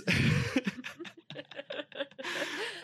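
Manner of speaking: high, breathy burst of nervous laughter; nervous relieved laugh. Retold or imitated. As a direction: laugh out the tension right after confessing. Relieved laugh after coming out.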